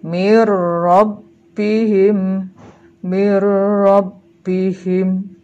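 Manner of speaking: Mir rabbihim loud and very slow, long rolled r, no n; merged reading. Shown as a demonstration. Teacher models idgham for pupils, slow merged recitation. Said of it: "seolah-olah hilang atau lebur ke dalam huruf berikutnya".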